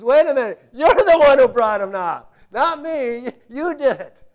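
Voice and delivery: loud, laughing protest; an incredulous, amused "you did it"; Moses pushing back. Acted. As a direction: throw it right back at God. Moses turning it back on God.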